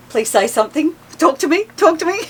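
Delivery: hammed-up plea to get him speaking; mock pleading. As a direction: beg him to talk, half-joking. Pleading look to silent husband.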